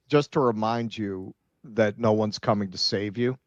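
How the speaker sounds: ordinary talk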